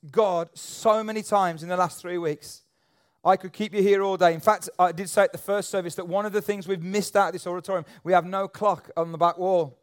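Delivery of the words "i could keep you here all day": ordinary talk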